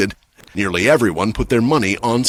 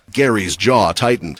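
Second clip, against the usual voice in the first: plain reading voice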